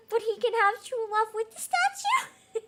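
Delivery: high, loud words through laughter; real laughter through the words; laughing. Real, not acted. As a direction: laugh while gushing it out. Laughing fan comment.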